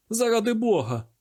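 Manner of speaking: high, bewildered plea; a touch of panic, acted; distraught exclamation. A narrator's reading. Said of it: "вигукнув він, пополотнівши й розгубившись".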